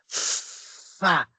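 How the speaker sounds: Fá with a long hissed F before the vowel; dictated sound. Shown as a demonstration. Teacher dictating to pupils, drawn-out F in Fá. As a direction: hold the f, then release Fá. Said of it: "o som do que o tio Auri está falando".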